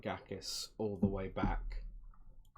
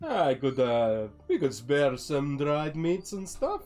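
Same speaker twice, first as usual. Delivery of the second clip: higher, slow in-character trader voice; friendly Vistani trader; 6 semitones higher, 10 dB louder, slower than usual. Acted. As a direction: haggle amiably as a travelling merchant. Vistani offers food for sale.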